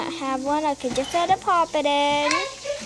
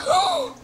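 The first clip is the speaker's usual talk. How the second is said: high excited gasp; 13 semitones higher than usual. Pretend. Thrilled that the trade was accepted.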